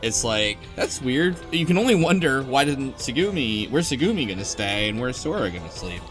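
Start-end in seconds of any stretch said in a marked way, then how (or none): none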